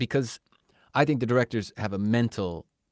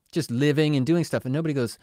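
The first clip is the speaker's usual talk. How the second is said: usual speaking voice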